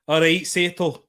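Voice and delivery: put-on Scottish greeting; Scottish accent. Shown as a demonstration. Streamer showing off to viewers, attempted Scottish accent. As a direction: try out a rough Scottish accent. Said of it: "I can do a good Scottish accent".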